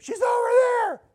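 a shrill, strained yell across the river; retold yell. Yelling to everybody in the raft, a high shout. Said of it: "And I yelled at everybody".

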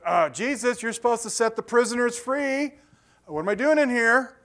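playing John, baffled and pleading; mock indignant prisoner; exasperated plea. John the Baptist voiced complaining.

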